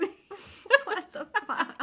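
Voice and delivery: high, loud, slow and laughing; laughing disbelief. Real, not acted.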